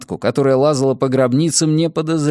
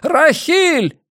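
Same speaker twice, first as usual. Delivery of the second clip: shouting; much slower than usual. A narrator's reading. Old man calls to his daughter by the tomb, shouting. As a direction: call out far across the wind, pitch leaping up. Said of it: "Крикнул".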